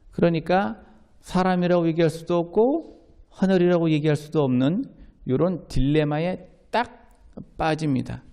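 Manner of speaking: usual speaking voice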